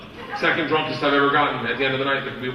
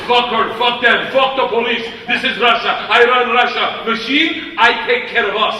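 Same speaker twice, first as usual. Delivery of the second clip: put-on Russian accent; 6 semitones higher, 6 dB louder than usual. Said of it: "And Igor goes".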